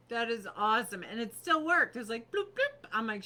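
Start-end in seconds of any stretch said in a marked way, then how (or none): 2.3-2.9 high, loud mock beep of a car unlocking; beeping bloop. Imitating the truck unlocking, high chirpy beeps. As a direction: chirp it like a key fob.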